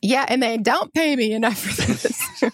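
laughing through the joke. Real, not acted. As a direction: let the laugh spill into the words. Jokes about pay while laughing.

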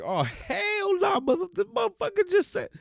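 a shocked fan squealing in disbelief; outraged disbelief. Retold or imitated. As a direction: fan reacting to the player's insult, very high and outraged. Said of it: "that's when I was like".

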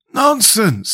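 high, sharp dismissal. A narrator's reading. Scarecrow rebukes the Pumpkinhead's wish, sharply. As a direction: cut him off sharply, pitched high. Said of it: "said the Scarecrow sharply".

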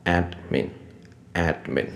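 慢慢念“admin”，分成ad、min两段; “admin”的d后面不带母音; 喉咙不动的d. Shown as a demonstration. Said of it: "但是喉咙不动". (慢速示范“admin”两个音节)

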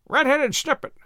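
high, squawky mocking parrot; croaking parrot. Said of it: "croaked Ginger mockingly".

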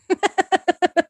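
high burst of laughter; real high giggle at a joke. Real, not acted. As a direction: laugh in a high, quick run.

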